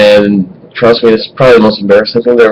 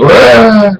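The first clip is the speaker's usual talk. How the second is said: high yell, below a shriek; retold dad's scream; 11 semitones higher, 4 dB louder than usual. Dad surprised on the toilet by mom, startled yell. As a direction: yelp like a dad caught out. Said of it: "And I hear my dad go".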